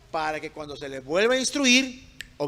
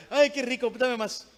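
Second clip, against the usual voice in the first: higher-pitched child pretending to relish it; mock delight; 5 semitones higher than usual. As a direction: sarcastically imitate a child asking for more. Mocks a child enjoying punishment.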